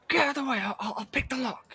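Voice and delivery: barking at the others to move aside; impatient shove. Acted.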